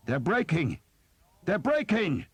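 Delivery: high, loud triumphant cry; shouted news. A narrator's reading. Soldier announcing the French retreat, high and loud. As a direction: yell it across the field as the enemy gives way. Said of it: "who shouted that news".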